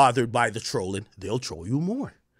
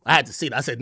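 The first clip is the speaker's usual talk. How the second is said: ordinary talk, nothing marked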